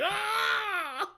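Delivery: long, high mock scream; crazed scream. Acting out a Marine going crazy, screaming. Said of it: "Jaha looks more crazy. He's like".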